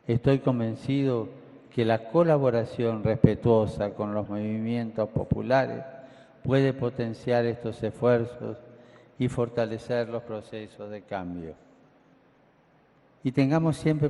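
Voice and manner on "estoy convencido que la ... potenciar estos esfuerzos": ordinary talk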